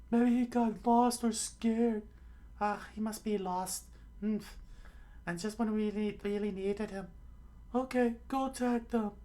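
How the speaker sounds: very high falsetto fretting; high put-on dialogue voice; fretting companions. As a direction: worry aloud in a squeaky cartoon voice.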